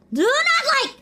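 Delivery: high, loud "Do not like!"; loud protest. Real, not acted. Streamer protesting a sad game scene, loud and high. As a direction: shout it back at the game. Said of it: "repeated protest".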